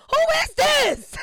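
high, loud slow shout; mock shout. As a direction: yell it, fake not knowing. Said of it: "I was like".

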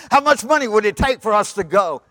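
loud, high desperate offer; acted urgent appeal; urgent bargaining. Daddy bargains to get aboard.

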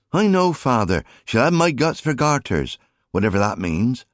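put-on high, bright boy's voice, shrugging it off; cheeky boy Alfie. A narrator's reading. Alfie answers with a smile.

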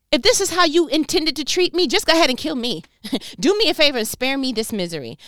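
loud, high, despairing cry; desperate plea. Acted. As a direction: beg God to end it. Moses begging to die.

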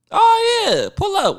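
high-pitched imitation of an agreeable friend; friend's easy welcome. Imitating his friend Jordan on the phone, breezily welcoming. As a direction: lightly voice a friend saying come on over.

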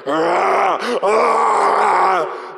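loud roaring ah ah like a lion; mock lion roar. Pastor acting the lion in a clip, loud roar. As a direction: roar it out like a beast. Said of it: "And this lion's like".

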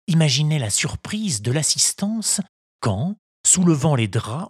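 plain reading voice

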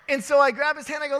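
ordinary talk, nothing marked

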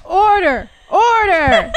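mock judge banging for order; shouting order order. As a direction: call the room to order, stern. Mock judge calls the court.

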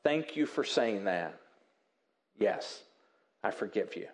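soft and quiet, sincerely forgiving; gentle forgiveness. Shown as a demonstration. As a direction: grant forgiveness gently, softly. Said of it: "So a better response is".

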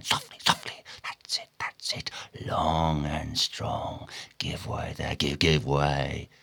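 very soft, whispery coaxing; low, barely voiced encouragement; hushed urging. A narrator's reading. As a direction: whisper the rowers along gently. Stubb hushing crew's stroke.

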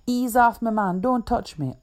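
high, loud frantic protest; panicked plea. Acted. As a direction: back off, half laughing in panic. Donovan panics being tickled.

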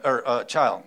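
normal talking voice

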